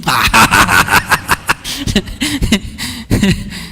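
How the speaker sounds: soft, falling chuckle after the punchline; laughs. Real, not acted. Preacher laughing at his own bar story, soft chuckle. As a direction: let a low laugh roll off.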